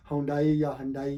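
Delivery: हुंडई then हंडाई, both ending in "dai"; "haun-dai" or "hun-dai" as mistakes in हुंडई या हंडाई; wrong variants. Shown as a demonstration. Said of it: "इसको ना पढ़ें".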